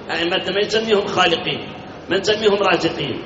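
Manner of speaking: ordinary talk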